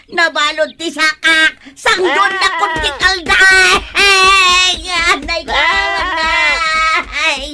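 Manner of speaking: high, loud frantic yelps and calls for help; panicked cries for help. Acted. Tangled in the rope, shouting for help as the goat runs. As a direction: scream in pain and panic as the goat drags you.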